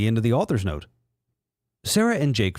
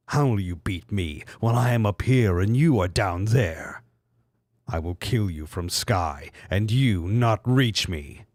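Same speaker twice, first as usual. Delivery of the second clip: lower, menacing mock villain; 4 semitones lower than usual. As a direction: announce doom like a cartoon baddie. Villain Mew three.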